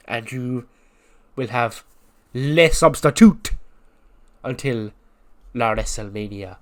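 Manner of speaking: emphatic, hammed-up French teacher; drawn-out French accent, dramatic pauses. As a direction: scold them grandly in a mock French accent. French teacher correcting the crowd.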